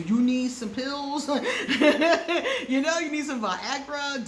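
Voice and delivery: high, loud laughing tease. Real, not acted. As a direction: giggle through the joke. Teasing suggestion, laughing.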